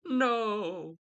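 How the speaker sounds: high, flat refusal in a put-on voice; defiant Juliet. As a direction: have Juliet refuse her father outright. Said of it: "And then she's like".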